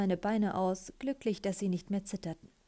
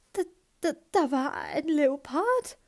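high, halting stammer of shock; erschrockenes Stammeln; 7 semitones higher, 4 dB louder, slower than usual. A narrator's reading. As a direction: stammer it out slowly, pitch jumping up. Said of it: "stammelte sie". (erschrocken stammelnd, mit hoher Stimme)

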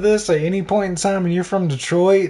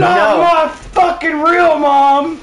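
put-on high, slow whiny teen voice; bratty teen; 11 semitones higher, 4 dB louder, slower than usual. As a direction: whine it like a sulky kid.

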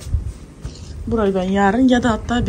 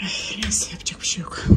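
much softer whispered sigh of giving up; resigned whisper; 11 dB quieter than usual. Real, not acted. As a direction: sigh it out under your breath. Sighing resigned aside. (pes etmiş fısıltıyla iç çekme)

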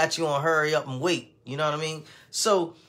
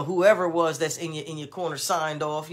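normal talking voice

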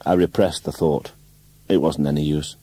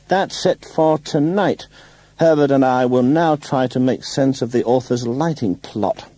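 high, stagy announcing voice; theatrical director; 7 semitones higher than usual. A narrator's reading. Ronnie sending the cast home for tonight, theatrically announced. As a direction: dismiss the company with actorly projection.

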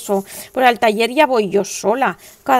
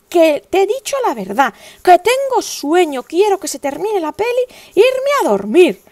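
higher, louder exasperated mother; firm retort; 7 semitones higher, 5 dB louder than usual. Retold or imitated. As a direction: insist you're tired and want bed. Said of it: "retorting to son at night".

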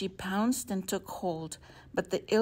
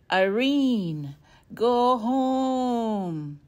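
loud, booming wind voice; howling wind; 4 semitones higher, 8 dB louder, much slower than usual. A narrator's reading. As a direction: roar it like a storm. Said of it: "the wind squalled".

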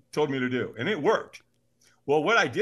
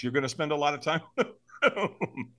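laughing. Real, not acted. Storyteller quoting his buddy's instructions, laughing. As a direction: laugh breaking into the words. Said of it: "I'm laughing, you know, right?".

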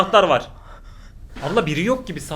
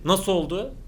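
normal talking voice

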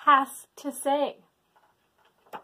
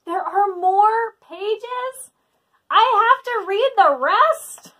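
higher, louder, rising whine; exasperated disbelief; 9 semitones higher, 7 dB louder than usual. Real, not acted. Exasperated at more pages.

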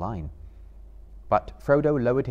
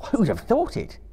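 a much higher astonished exclamation; exclaiming; 13 semitones higher, 4 dB louder than usual. Acted. Frodo amazed at Sam's rope, exclaiming high. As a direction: burst out in wonder. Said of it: "Frodo exclaimed".

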